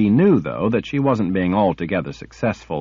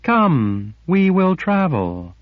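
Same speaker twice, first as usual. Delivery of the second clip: eager; 8 semitones higher, slower than usual. A narrator's reading. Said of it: "eager to satisfy".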